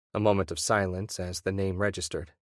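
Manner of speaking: plain reading voice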